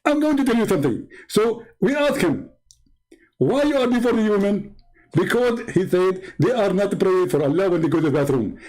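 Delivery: a smug preacher figure explaining his proof; mock Zakir Naik. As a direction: lecture the caller with mock confidence. Preacher figure continues the story.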